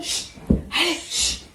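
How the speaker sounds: whispering over a crib. Real, not acted. Hushing kids to sleep.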